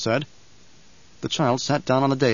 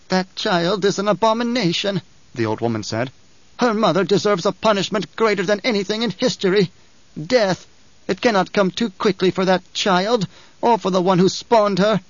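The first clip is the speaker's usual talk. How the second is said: higher, lightly voiced old woman in condemnation; old truth-sayer; 7 semitones higher than usual. A narrator's reading. Old truth-sayer condemning Alia to the Emperor. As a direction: condemn the child with cold zeal.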